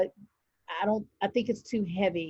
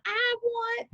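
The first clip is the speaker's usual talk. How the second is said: very high, childish mock demand; put-on little-kid voice, pitched way up; 13 semitones higher than usual. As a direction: squeal it like the needy kid. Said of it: "that one is like".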